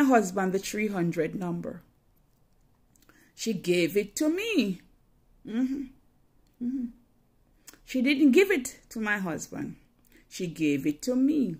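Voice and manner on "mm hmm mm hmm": ordinary delivery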